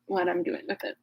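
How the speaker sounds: ordinary delivery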